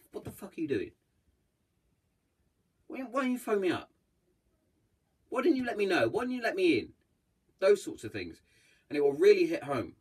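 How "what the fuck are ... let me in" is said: high, exasperated telling-off; rising from anger to hurt pleading; angry scolding. Retold or imitated. Friend scolding someone who hid their struggle, high and exasperated. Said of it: "kick up the ass".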